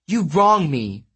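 high, mildly wailing protest; a hurt god complaining, understated. A narrator's reading. Apollo protests to his sister in a high, mild wail. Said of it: "Apollo wailed".

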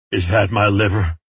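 ordinary delivery

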